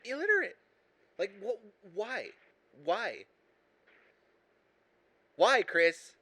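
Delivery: high, exasperated outburst. Real, not acted. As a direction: voice climbing high into a loud demand. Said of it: "lower your, lower your voice, [name]".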